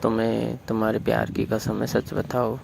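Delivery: usual speaking voice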